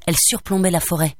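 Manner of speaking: ordinary delivery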